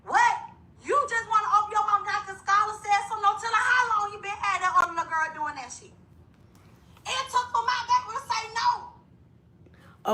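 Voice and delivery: high, outraged ranting; seething and disbelieving; getting hot. Real, not acted. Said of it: "I'm getting hot".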